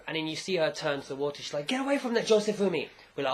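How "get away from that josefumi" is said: put-on high, louder mother's call to a child; mock scolding call. Woman turns to child at the water, mock scolding call. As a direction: call the child away urgently, play-acting.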